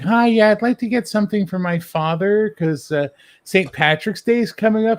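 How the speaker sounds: high, loud put-on woman's voice; high mock lady asking for a gift; customer voice. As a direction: play the sweet customer in the sketch. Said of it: "she's like".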